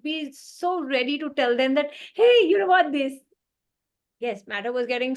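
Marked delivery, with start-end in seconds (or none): 2.1-3.1 high, loud eager blurt. Retold or imitated. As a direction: burst out with the answer eagerly. Eager futurist blurting out answers.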